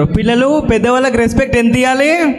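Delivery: ordinary delivery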